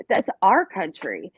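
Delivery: "our" drawn out slowly and stressed. Shown as a demonstration. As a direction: say "our country" slowly, weight on "our". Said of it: "I'd say".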